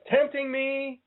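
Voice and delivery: "tempting me" sung up high and teasing; high teasing sing-song. Pretend. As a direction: swoop way up on "tempting me" playfully. Teasing about cookies in studio.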